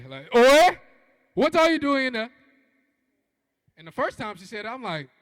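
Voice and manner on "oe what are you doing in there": loud high call; mother yelling through the door; calling mom. Retold or imitated. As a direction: West African mom shouting at her son. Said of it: "When my mom says it, it's like".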